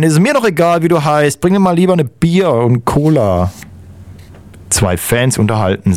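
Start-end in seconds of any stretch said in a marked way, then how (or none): 0.0-3.5 high-pitched acted yell of a fan; mock bellow. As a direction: holler it back, without full volume. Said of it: "brüllt ein Fan".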